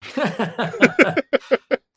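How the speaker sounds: a high, loud laugh; high burst of laughter. Real, not acted. Host asks for an impression, a high nervous laugh. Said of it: "What's a good impression you can do?".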